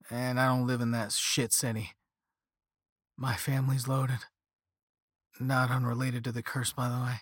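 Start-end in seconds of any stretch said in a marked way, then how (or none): none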